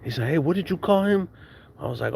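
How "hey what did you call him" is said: imitated startled challenge, pitched up high; tripped-out brother. Older brother questions his brother's friend, mock startled. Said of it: "his brother tripped out".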